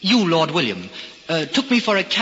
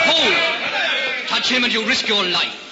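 commanding shout; 8 semitones higher, slower than usual. Acted. King halts the guards.

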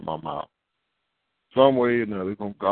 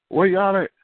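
higher, louder calling voice; grown child asking; 9 semitones higher, 4 dB louder than usual. Retold or imitated. Grown child phoning the parents, higher calling voice. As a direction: call out like a kid looking for the parents.

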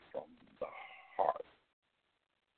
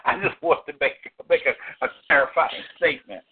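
loud, high, laughter-filled stumbling; high-pitched, tickled and loud; amused fluster; 13 semitones higher, 11 dB louder than usual. Real, not acted. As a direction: trip over the words while cracking up. Flustered clarifying amid laughter.

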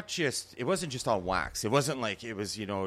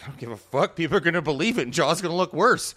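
louder, higher, dismissive comeback; mock defiant retort; 6 semitones higher, 8 dB louder than usual. Acting out a rapper's reply to doubters, loud and defiant. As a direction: brush off the objection loudly, building as you go.

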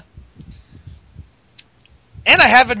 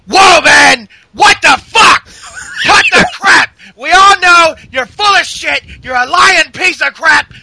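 mock furious rant. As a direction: scream it like a wrestler losing it on the mic. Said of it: "he's like".

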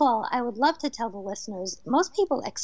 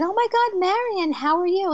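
high, loud delighted cry to a screaming bird; excited greeting; 7 semitones higher, 3 dB louder than usual. Retold or imitated. Woman greeting her late friend's spirit, thrilled.

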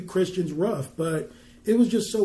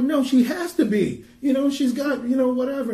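high, louder denial; disbelieving protest; 7 semitones higher, 4 dB louder than usual. Retold or imitated. Daughter denying her mother is healed, high and loud. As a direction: push back in shrill-free but raised disbelief. Daughter refusing doctor's news.

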